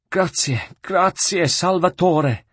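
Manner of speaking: high, breathless thanks; a saved friar clinging in relief; grateful monk. Acted. The rescued monk thanks Ezio, high and overcome.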